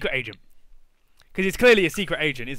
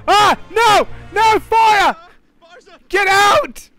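very high, loud screams of panic; frantic high screams at a kitchen fire; panicked screaming; 6 dB louder, much slower than usual. Real, not acted. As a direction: shriek in alarm at the fire, laughing in disbelief.

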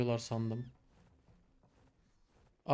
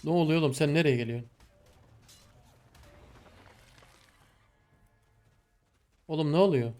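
startled shout; 4 dB louder, faster than usual. Real, not acted. Attacked suddenly in game.

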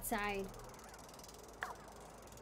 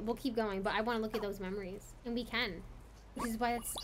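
normal talking voice

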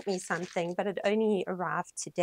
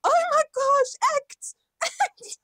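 much higher, reliving the excitement; retold squeal; 8 dB louder than usual. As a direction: replay your own squeal of delight. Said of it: "I was like".

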